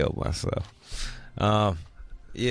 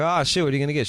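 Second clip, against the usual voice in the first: fake cheerful; 7 semitones higher, much faster than usual. Dad's fake upbeat reply.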